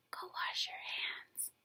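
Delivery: soft unvoiced whisper. Teacher quietly sends a sneezing boy to wash, whisper. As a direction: hush it so only he hears.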